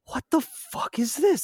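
high-pitched, slow-drawn bafflement; stunned disbelief. Retold or imitated. Player finds a party in the bunker, stunned. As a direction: re-enact being utterly baffled, pitch way up.

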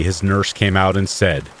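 ordinary delivery, nothing marked

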